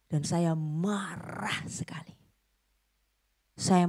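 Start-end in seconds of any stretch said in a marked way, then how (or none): 0.1-2.1 slow, seething whisper; anger. Retold or imitated. Preacher voices the boy's quiet rage in a slow whisper. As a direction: hiss the anger out slowly. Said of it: "saya stop nangis".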